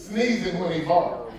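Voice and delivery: usual speaking voice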